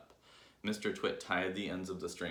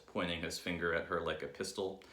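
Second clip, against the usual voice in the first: ordinary delivery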